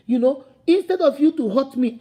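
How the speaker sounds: normal talking voice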